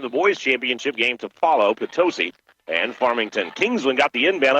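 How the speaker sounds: usual speaking voice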